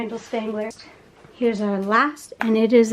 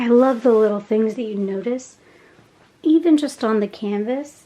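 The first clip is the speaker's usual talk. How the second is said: ordinary delivery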